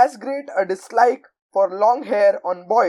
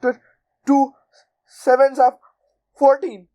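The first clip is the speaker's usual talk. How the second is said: higher, louder stammering gasp; terrified boy gasping; 5 semitones higher, 4 dB louder than usual. A narrator's reading. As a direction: choke the words out in fright. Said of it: "gasped Rupert".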